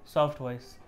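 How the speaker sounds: low and very soft; hushed soft demo. Shown as a demonstration. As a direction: barely voice it.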